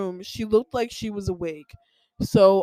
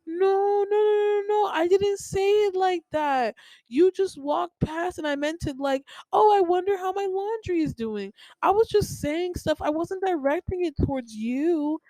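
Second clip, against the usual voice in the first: high, defensive mock mom voice, much higher; imitating mom; 8 semitones higher than usual. Daughter mocking mom denying she meant anything, high defensive voice. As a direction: pitch up into a whiny mom protesting her innocence. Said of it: "she'll be like".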